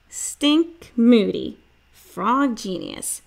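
slow, proud announcing; grand title. A narrator's reading. Narrator crowning Stink with a slow proud title. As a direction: lay it on like a title.

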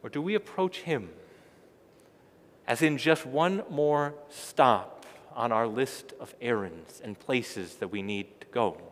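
ordinary talk, nothing marked

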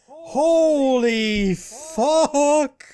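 very drawn-out, high-pitched shock; stunned exclamation. Real, not acted. Gamer shocked by a near disaster, stunned exclamation. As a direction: stretch both words out in loud disbelief.

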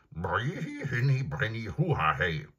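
lauter, gewieherter Pferdename; wiehernder Name. Acted. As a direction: den Namen wie ein Wiehern ausstoßen. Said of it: "antwortete das Pferd". (Pferd nennt seinen wiehernden Namen)

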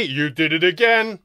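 loud, drawn-out cross accusation. A narrator's reading. First alien interrupting his partner, cross. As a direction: cut in crossly, slow and loud. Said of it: "number one said crossly".

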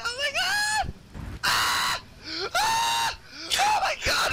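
high panicked screams; panicked screaming. Real, not acted. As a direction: scream for your life. Overrun by zombies, panicking.

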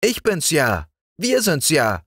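put-on high, bright child voice; cheerful young girl talking, pitched well up; little girl Liesel. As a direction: speak up like a fearless little girl. Liesel calls to the dog.

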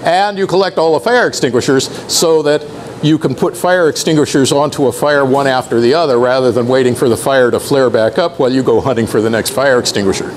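ordinary delivery

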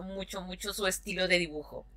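ordinary delivery

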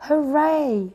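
high chick-like hooray; excited cheer. A narrator's reading. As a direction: cheer with delight. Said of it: "they cried".

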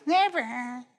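high, light, wavering never; non-committal never, lifted in pitch; wrong, weak never. Shown as a demonstration. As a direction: toss out a high, unconvincing little never. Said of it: "Can't be one of these".

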